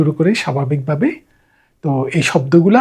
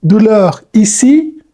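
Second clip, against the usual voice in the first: douleur ici drawn out very slowly, louder; slow model phrase; 3 semitones higher, 4 dB louder, much slower than usual. Shown as a demonstration. Teacher repeating the phrase for telling a doctor it hurts. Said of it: "তখন আপনি বলবেন".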